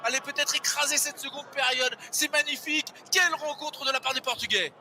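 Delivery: fast live rugby play-by-play; live sports caster. Real, not acted. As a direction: narrate the action as it unfolds. (commentateur sportif en direct)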